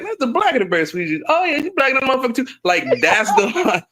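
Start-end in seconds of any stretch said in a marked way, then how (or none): 0.0-2.5 quoting Chris Tucker. Retold or imitated. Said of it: "He's like".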